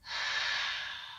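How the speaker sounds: long unvoiced breath out; audible exhale. Shown as a demonstration. Teacher demonstrating the out-breath to the class, a long audible exhale. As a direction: breathe out through the mouth. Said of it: "Nice big breath in this gentle twist".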